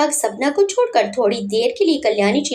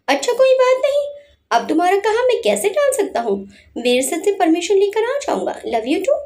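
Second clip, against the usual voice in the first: fake sweet phone talk; 7 semitones higher than usual. Fake phone call to make Sapna jealous.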